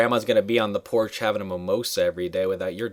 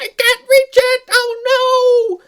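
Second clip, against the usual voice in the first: very high, loud straining wail; mock struggling; 8 dB louder, much slower than usual. Queen strains for the key under the glass, high mock-struggling cry. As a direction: squeal it in cartoon helplessness.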